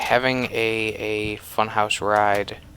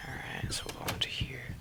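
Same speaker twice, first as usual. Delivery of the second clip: whispering; 3 semitones higher, 15 dB quieter than usual. Real, not acted. Creeping into a new room.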